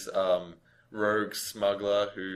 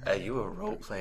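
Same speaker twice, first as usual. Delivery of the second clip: sultry announcer voice; 4 semitones higher than usual. Acted. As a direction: purr it like a late-night ad. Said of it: "like, a sultry voice".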